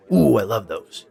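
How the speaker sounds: plain reading voice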